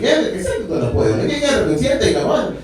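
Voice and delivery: animated imitation Spanish reply; mock Spanish. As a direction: act out an eager answer in mock Spanish. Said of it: "talking to each other in Spanish".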